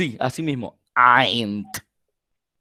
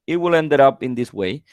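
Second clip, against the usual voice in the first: ordinary delivery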